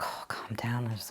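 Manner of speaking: hushed whisper, calming herself down; whispering self-reassurance. Retold or imitated. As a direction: breathe it out, trying to settle nerves.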